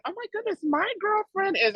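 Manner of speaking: high, gushing admiration; high excited praise for a friend. Retold or imitated. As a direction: squeal it high with pride. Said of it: "I was like".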